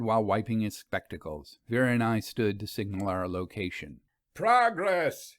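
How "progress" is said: a bright, higher call of good news; shouted. A narrator's reading. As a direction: call out the news across the churchyard. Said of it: "he shouted as he walked toward us".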